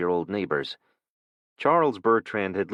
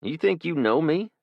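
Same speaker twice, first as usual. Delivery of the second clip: challenging; 10 semitones higher, 3 dB louder than usual. Acted. Man challenges thug.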